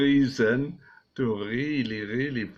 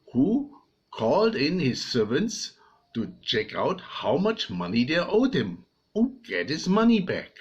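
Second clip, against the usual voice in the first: ordinary talk, nothing marked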